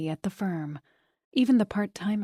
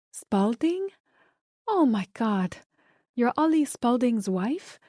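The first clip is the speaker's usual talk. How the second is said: put-on surprised Spanish accent; 4 semitones higher than usual. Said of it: "with a thick Spanish accent".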